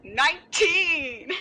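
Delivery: loud, drawn-out, rising triumph; triumphant shout. Real, not acted. Player announcing a high roll, triumphant. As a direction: belt out the winning number.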